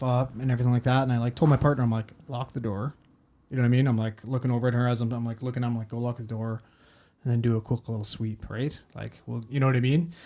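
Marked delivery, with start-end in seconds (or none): 2.3-2.9 hushed aside to a partner; discreet instruction. Retold or imitated. Covert order to partner, retold. 5.9-8.5 low, hushed aside to a partner; discreet instruction. Retold or imitated. Covert order to partner, retold.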